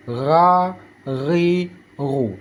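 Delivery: "Ra, ri, ru" as gargled GH; throaty buzz through "Ra, ri, ru"; vibrating ghain. Shown as a demonstration. Teacher showing the vibration, "Ra, ri, ru" gargled. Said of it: "cara bacanya seperti ada ge".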